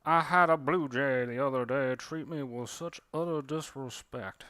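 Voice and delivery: put-on a proud cardinal demanding civility, Southern accent. A cardinal grumbling about blue jays, affronted Southern accent. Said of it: "that's a cardinal talking about blue jays".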